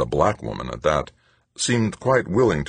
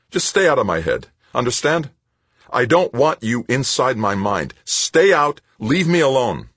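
higher, louder, hostile; angry warning; 9 semitones higher, 6 dB louder than usual. Acted. Tavalera angrily warning Stoner to stay out. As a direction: warn him off angrily.

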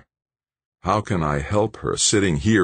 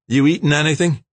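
higher and brighter, a big Aussie bloke talking up the night; young Aussie George; 12 semitones higher, 5 dB louder than usual. Acted. George's voice in the story.

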